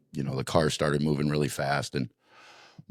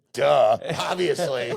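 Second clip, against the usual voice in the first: laughing sarcastic duh; 8 semitones higher, slower than usual. Retold or imitated. Answering officer sarcastically.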